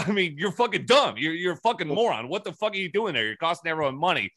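replaying the fight, getting heated again; retold angry outburst. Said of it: "I'm like".

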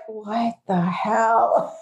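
very slow, drawn-out mock dismay; mock-appalled mom, dragging every word; imitated appalled mother. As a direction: stretch it out like Mom hearing herself mocked. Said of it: "so be like".

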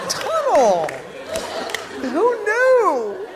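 high, stretched-out cry; hammed-up shocked wail; panicked driver. Panicked driver discovering the tunnel.

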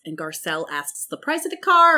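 ordinary delivery

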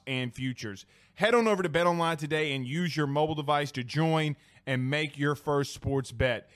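soft, even sponsor read. Acted. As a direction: read the ad copy calmly. Reads a sponsor ad.

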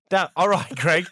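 put-on Australian greeting; Australian accent. Podcaster retries the greeting for Greg, Australian accent. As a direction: have another go at a mock Australian accent. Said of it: "doing an Australian accent".

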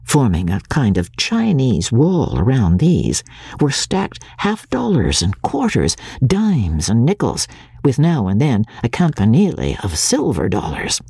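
ordinary delivery, nothing marked

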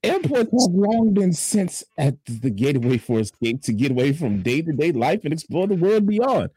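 news read. Acted. Fill-in anchor reads the airport story, mock news read. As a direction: stretch key words like a grand newsreader.

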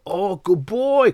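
high, slow, drawn-out praise; lavish praise. Retold or imitated. Owner rewarding the dog, slow and high.

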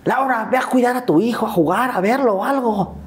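high, calling grandmother's voice. Retold or imitated. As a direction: call upstairs to send the daughter to the boy. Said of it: "le grita a Laura".

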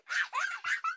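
put-on high, far-off little child's giggle; a small boy's delighted giggle. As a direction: giggle like a toddler watching a digging dog. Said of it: "sending Robbie into fits of delighted giggles".

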